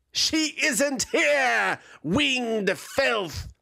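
high, slow defiant shout; proud shout. Acted. Draconid warrior shouting defiance at the dragon. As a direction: fling the insult back with pride. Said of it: "a proud voice shouted from behind".

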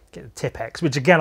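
ordinary talk

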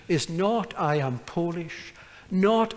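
ordinary talk, nothing marked

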